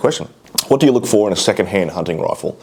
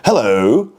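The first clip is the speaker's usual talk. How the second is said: louder sing-song ad-man greeting, dipping then swooping up; Frank Walker; 3 semitones higher, 4 dB louder than usual. Acted. Vlogger mimicking a National Tiles advert, swooping ad-man impression. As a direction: call out a drawn-out, rising hello. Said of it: "like fucking Frank Walker from National Tiles".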